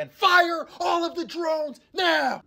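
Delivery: high, drawn-out dramatic yell; slow, high-pitched theatrical cry; villain's command. Acted. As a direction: stretch each word into a hammed-up order. Acted villain command from the film.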